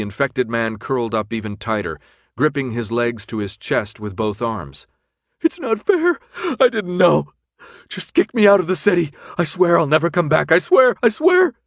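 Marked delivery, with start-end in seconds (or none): 5.4-11.5 high, loud, frantic begging; desperate sobbing plea. A narrator's reading. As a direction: beg for your life in a wail. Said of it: "broke into another agonizing series of lurching sobs".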